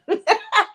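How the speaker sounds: a short high laugh at her own slip; amused laugh. Real, not acted. Laughing at her own tangled line, a bright laugh. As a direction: laugh it off, caught tripping.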